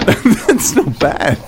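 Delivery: talking through laughs; slow words broken by laughter; laughing. Real, not acted.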